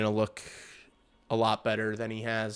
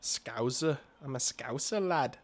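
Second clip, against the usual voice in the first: put-on Scouse, lilting up at the end; Scouse accent; 3 semitones higher, 3 dB quieter than usual. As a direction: have a go at a Liverpool Scouse accent. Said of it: "Supposed to be a, uh, Scouse".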